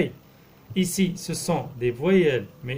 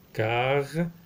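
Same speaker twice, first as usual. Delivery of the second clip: syllable by syllable. Shown as a demonstration. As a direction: sound out "Cartable" with gaps between syllables. Teacher sounds out word by syllables.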